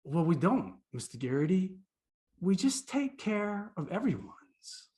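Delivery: put-on slow, simple small-boy answer; little first grader. First grader explaining to visitor, slow lightly imitated child. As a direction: lightly voice a child explaining, drawing out the last line. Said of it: "he looked at me, this little first grader".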